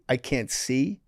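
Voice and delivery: ordinary talk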